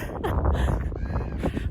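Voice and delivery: short breathy laugh at herself. Real, not acted. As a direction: laugh it off at yourself. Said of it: "Laughing at myself".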